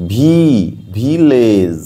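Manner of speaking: ভিলেজ with a long ee in ভি; sounded-out pronunciation. Shown as a demonstration. Teacher showing that i says ee, ভিলেজ sounded out slowly. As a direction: hold ভি as a long ee, then say ভিলেজ drawn out. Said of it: "আয়ের উচ্চারণ ই".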